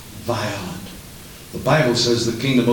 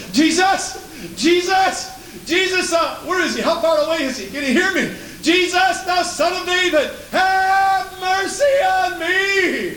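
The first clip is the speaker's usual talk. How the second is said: desperate scream. Acted. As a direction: cry out to be heard by someone passing far away. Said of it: "He screamed".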